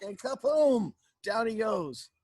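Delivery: loud, high storytelling burst; explosive kapoom. A narrator's reading. Storyteller knocks out the lion, explosive kapoom. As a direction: boom it out as he topples.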